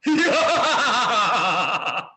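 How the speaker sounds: big booming rakshas laugh; loud demon laugh. Shown as a demonstration. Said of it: "जो कोई जोर से हंसेगा, उसको राक्षस कहेंगे". (राक्षस जैसी ज़ोरदार हंसी)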